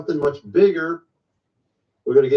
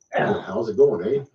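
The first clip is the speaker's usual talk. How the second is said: put-on a light Canadian accent on the greeting; breezy Canadian-accented hello; 6 semitones lower, slower than usual. Imitating Michigan locals, a light Canadian accent. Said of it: "That's how they talk up there".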